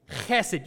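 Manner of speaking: "Chesed" with a throaty, scraped ch; "Chesed" starting from deep in the throat; throaty Hebrew sound. Shown as a demonstration. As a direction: say "Chesed" like something's in the back of your throat. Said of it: "like there's something in the back of your throat".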